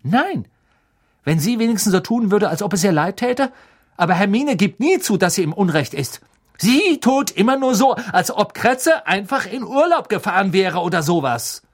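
high, loud, indignant rant; angry outburst. A narrator's reading. Ron complains to Harry about Hermine, angry and indignant.

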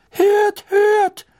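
very high, loud squeak; squeaky. Acted. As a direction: squeak it like a tiny man. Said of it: "quiekte der kleine Professor Flitwick".

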